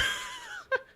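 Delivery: shrill-pitched giggle in the put-on voice; high laugh; high squeaky laugh. Real, not acted.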